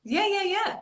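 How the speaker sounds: high, eager run of yeahs; excited agreement. Real, not acted. Skater agrees they met long ago, excitedly. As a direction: pile on the yeahs, delighted.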